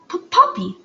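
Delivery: high, stuttered name; nervous stutter. A narrator's reading. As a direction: catch on the first sound. Frightened girl gives her name.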